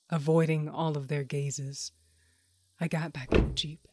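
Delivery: usual speaking voice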